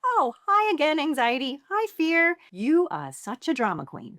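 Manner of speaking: high, sing-song teasing of fear; playful high mock welcome; mock greeting. Shown as a demonstration. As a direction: greet your fear like a cheeky friend. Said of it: "one's a little more whimsical".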